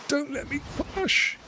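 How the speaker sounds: squeaky little high voice; high mock plea. Gamer plays at pleading not to crush, high mock plea. Pleading aside while playing.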